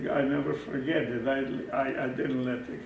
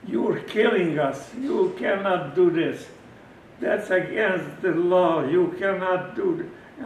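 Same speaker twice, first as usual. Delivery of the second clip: loud, slow, angry warning; loud threat; 4 semitones higher, 8 dB louder, slower than usual. Retold or imitated. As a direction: lay down the law, loud and deliberate. Threatens doctor with captain.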